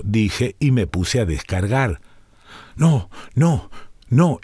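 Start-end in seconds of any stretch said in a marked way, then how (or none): 2.8-4.4 desperate cry. A narrator's reading. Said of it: "exclamó Iwa".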